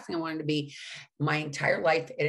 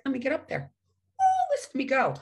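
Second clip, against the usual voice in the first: put-on drunk showing off; 8 semitones higher, 4 dB louder than usual. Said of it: "and I'd be like".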